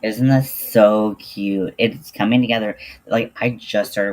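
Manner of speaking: delighted, slow and loud; excited. Real, not acted. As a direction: gush over it. Showing off her crochet project.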